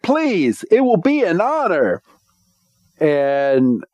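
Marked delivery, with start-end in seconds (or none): 0.0-2.0 much higher, eager and polite; a boy gushing with respect; eager Ash. Retold or imitated. Ash accepts the knight's offer, much higher and eager. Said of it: "And Ash is like".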